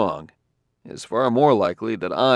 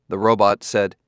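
ordinary delivery

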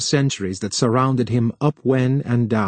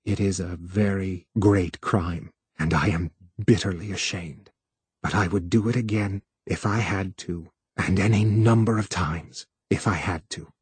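deeply sincere; 4 semitones lower, 5 dB quieter than usual. Acted. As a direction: confess it quietly, meaning every word. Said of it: "he meant it deeply".

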